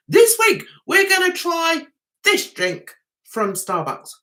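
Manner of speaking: high-pitched vlogger imitation; mock his eager drink-review opening; mock vlogger intro.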